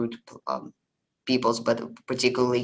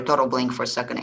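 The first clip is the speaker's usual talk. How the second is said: normal talking voice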